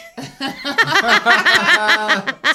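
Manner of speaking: bursting, high laugh; loud laugh. Real, not acted. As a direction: let a big laugh out. Laughing at the punchline.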